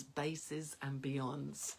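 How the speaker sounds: slowly, deliberately drawn out; slow recital. Shown as a demonstration. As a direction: savor each word like a mantra. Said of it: "I said it out loud like this".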